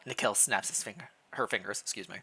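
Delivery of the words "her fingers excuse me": ordinary talk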